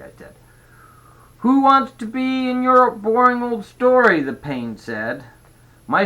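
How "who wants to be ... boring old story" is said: put-on high, loud bratty kid voice; scornful little brother. A narrator's reading. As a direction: jeer it in a high kid's voice. Brother scoffs at the story.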